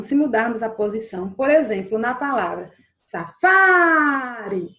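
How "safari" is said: "safari" with "fa" loud and greatly drawn out; stress on "fa". Shown as a demonstration. Teacher asking where the voice lingers, stretched "fa". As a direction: hold the middle "fa" of "safari" long and loud. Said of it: "onde foi que eu passei mais tempo?".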